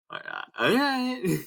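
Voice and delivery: high, drawn-out grudging "All right."; mock reluctant. Sandwich guy retold, grudging and high. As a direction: give in like it's absurd.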